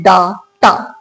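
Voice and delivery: डाटा split slowly as "daa... taa"; long open "daa" in डाटा; common mispronunciation. Shown as a demonstration. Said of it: "हम ज्यादातर इस word को पढ़ते हैं".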